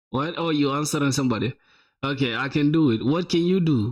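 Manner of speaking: normal talking voice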